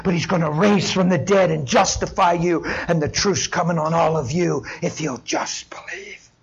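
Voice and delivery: normal talking voice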